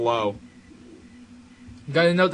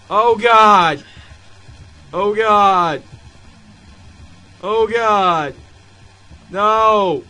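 loud, high cries falling away; dismayed wailing; 8 semitones higher, 10 dB louder, much slower than usual. Real, not acted. Player dreading what comes next, dismayed wail. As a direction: wail each one in dread.

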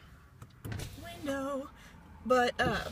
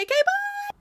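bright squeaky high sign-off, much louder; excited goodbye; 7 dB louder than usual. Real, not acted. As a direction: chirp a big cheery bye to the viewers. Cheerful sign-off to viewers.

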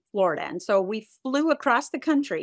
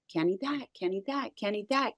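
softer, repetitive rejection under the breath; muttered checklist; 6 dB quieter than usual. Retold or imitated. Mom scanning store aisles for safe food, muttered to herself. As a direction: tick each one off quietly to yourself.

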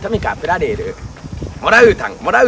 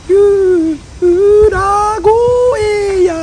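high falsetto cry, drawn out slowly; 10 semitones higher, 6 dB louder, much slower than usual. Shown as a demonstration. Comedian shows off a ghostly falsetto voice. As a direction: crack up into a wailing falsetto.

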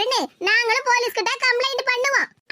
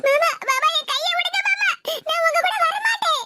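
upset protest; 7 semitones higher than usual. Acted. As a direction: pull away from mama, crying out. Girl resisting uncle's grip. (மாமாவிடம் கெஞ்சிக் கத்தும் குரல்)

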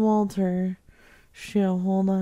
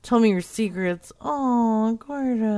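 ordinary talk

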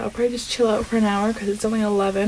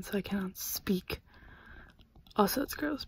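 whispering; 3 semitones lower, 15 dB quieter than usual. Real, not acted. Whispering with a retainer in.

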